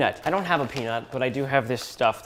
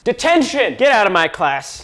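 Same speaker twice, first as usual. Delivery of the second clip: higher, louder mock detention order; mock teacher outburst; 6 semitones higher, 5 dB louder than usual. Teacher throwing a student out over a gross sandwich, mock outburst. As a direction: throw them out of class in a hammed-up teacher voice.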